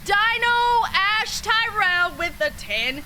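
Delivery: high slow hyped announcement; excited. Real, not acted. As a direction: hype the gift up. Big gift announcement.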